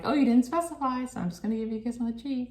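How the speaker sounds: high, fast sing-song dismissal; coy brush-off. Retold or imitated. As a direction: brush him off coyly. Voicing her dismissive reply.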